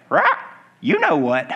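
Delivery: squawk, then parrot talk; talking parrot with an opening squawk. Acted. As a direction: deliver a parrot's punchline. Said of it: "Parrot said".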